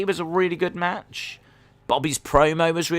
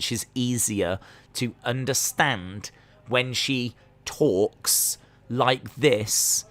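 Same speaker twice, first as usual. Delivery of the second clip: slow, spaced-out words with long gaps; talking slow; 4 dB quieter, much slower than usual. Retold or imitated. Mocking a wrestler's slowed promos for her accent, very slow. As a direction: imitate someone told to talk very slowly. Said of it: "told her to talk slow".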